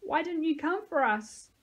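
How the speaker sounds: put-on high, plaintive child's question; tearful. A narrator's reading. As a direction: plead as if starting to cry. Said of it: "He started to cry".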